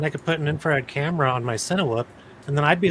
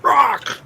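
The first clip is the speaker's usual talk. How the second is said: sudden loud, high bark; clown jump-scare roar; 11 semitones higher, 7 dB louder than usual. Retold or imitated. Clown popping out at the drone pilot, mock roar. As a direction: burst out with an imitated scare roar. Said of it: "a clown just comes out".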